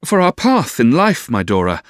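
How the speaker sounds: high, loud oratorical opening; warming to it. A narrator's reading. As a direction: launch into a rousing speech. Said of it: "warming with the subject".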